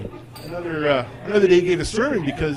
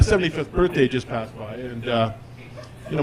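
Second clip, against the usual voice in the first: normal talking voice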